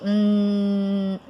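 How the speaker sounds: "hum" as a long steady nasal N; held N sound. Shown as a demonstration. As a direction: hold "hum" on one N hum. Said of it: "pra produzir o som do N".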